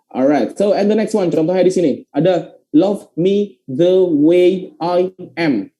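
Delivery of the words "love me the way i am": slow, word by word; measured classroom dictation pace; slow model reading. Shown as a demonstration. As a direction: read "Love me the way I am" out slowly. Teacher reads the example slowly.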